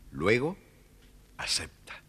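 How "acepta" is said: plain reading voice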